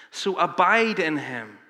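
slow, weighty call to abide; slow exhortation. Acted. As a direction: let each word land.

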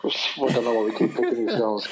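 normal talking voice

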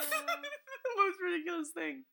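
high, slow laughing through it; high laughing incredulity; laughing disbelief. Retold or imitated. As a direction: laugh helplessly while saying it. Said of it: "I was like".

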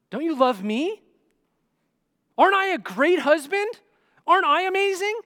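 loud, high needy questions; defensive, insecure. Acted. Insecure husband pressing his wife for reassurance, defensive and needy. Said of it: "he's a little defensive".

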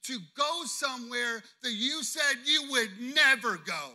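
loud, high, emphatic preaching; slowing into a booming final charge; the Lord commanding. Retold or imitated. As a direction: drive the command home at full force. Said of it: "the Lord's like".

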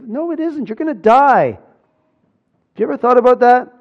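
sharp, loud and high; forceful rebuke. Acted. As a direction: cut the cliché down hard. Preacher rebukes the empty comfort.

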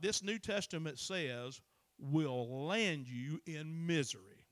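slow, stretched pulpit emphasis with loud bursts; forceful preaching. Acted. Preacher warning that the devil is a liar, slow and forceful. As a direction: hammer each phrase slowly at the congregation. Preaches against rejecting the book.

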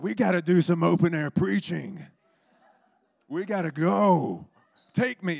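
loud, high zealous shout; Chris. Retold or imitated. Imitating an evangelist demanding open air preaching, loud urgent shouting. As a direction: holler it, urgent and impatient to go. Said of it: "he's like".

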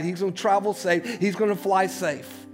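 ordinary talk, nothing marked

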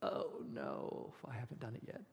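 whispered "oh no", caught out; a kid caught by parents coming home; panicked whisper. Retold or imitated. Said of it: "and you go".

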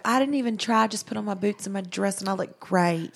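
Southern sweetheart voice; country girl. Acted. Imitating the girl men want, country-girl accent. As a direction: put on a casual country-girl twang. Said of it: "one of those girls that's just like".

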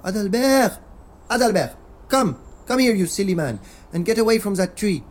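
calling out loudly. Acted. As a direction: shout across the garden. Calling husband out of tree.

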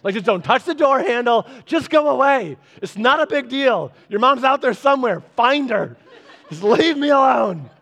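put-on shooing kids from the bathroom door; exasperated parent. Dad yelling at kids through the bathroom door, laughing. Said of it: "so I'm always like".

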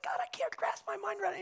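fast, high, breathless whisper; bewildered whisper. Retold or imitated. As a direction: whisper it, overwhelmed. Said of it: "we're like".

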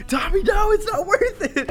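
shouting warning. Retold or imitated. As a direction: yell it to hold a friend back. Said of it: "we were like".

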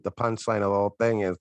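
normal talking voice

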